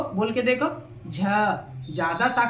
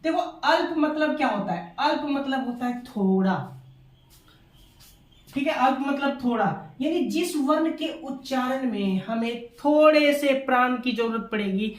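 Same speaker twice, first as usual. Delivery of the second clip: ordinary talk, nothing marked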